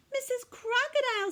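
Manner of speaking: high, loud call to the crocodile. Acted. Katy calling to Missus Crocodile, high and loud.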